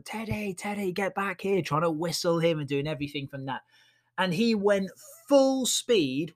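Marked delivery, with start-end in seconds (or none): 0.0-1.6 a higher mock call after a runaway dog; calling the dog back. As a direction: call the dog back across the beach, lightly. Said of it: "she was calling him back".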